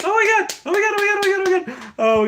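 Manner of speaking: very high, shrieking oh my God; gushing squeals at the name; excited squeal. Real, not acted. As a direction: squeal with delight. Squealing over a stuffed dog named Dashie.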